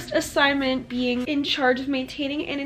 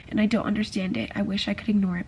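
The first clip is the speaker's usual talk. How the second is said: usual speaking voice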